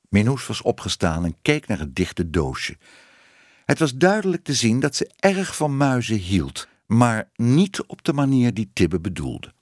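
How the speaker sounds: usual speaking voice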